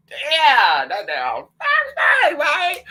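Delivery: high, loud, slow call to the guys; excited call. Retold or imitated. Flirting with Bronx guys, a high loud playful shout. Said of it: "Guys, I be like".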